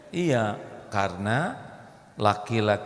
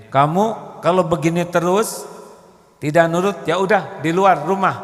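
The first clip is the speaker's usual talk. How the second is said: mother scolding; 5 semitones higher, 8 dB louder than usual. Retold or imitated. Mother scolds tantrum child.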